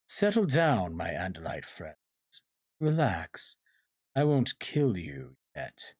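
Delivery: slow, mock-soothing menace; gloating villain. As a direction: stretch the words, toying with the captives. Villain soothes and threatens captives.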